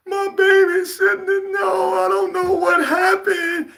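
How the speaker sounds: high, slow, hammed-up wailing on camera; mock a mother crying for the news cameras; exaggerated fake-sobbing mom; fake grieving mother. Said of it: "they get on the news and they".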